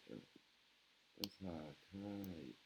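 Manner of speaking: pa, sa, thaï whispered syllable by syllable; whispered repeat. Shown as a demonstration. Teacher to French learners, repeating the Thai word in a whisper. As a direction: breathe Pa, sa, thaï with no voice.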